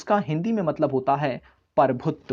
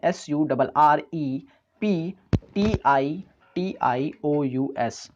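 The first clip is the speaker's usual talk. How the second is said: letter by letter; much slower than usual. Shown as a demonstration. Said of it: "spelling है".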